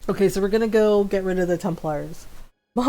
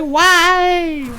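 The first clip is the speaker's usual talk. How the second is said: high, loud plaintive why; mock despair; 9 semitones higher, 6 dB louder than usual. Mom crying out to her daughter, mock despair. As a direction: cry out in hammed-up dismay.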